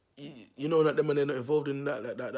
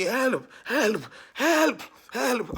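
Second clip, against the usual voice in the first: high, loud panicked cries; shouting for help; 9 semitones higher, 7 dB louder, much slower than usual. Retold or imitated. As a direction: cry out for help desperately. Neighbour screaming retold.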